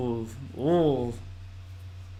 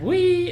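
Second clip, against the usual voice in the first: high-pitched drawn-out "wee"; long "wee" pitched way up; weird "wee". Retold or imitated. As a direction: imitate a weird, high held squeal. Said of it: "that weird".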